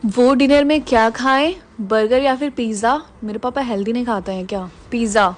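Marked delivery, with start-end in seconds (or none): none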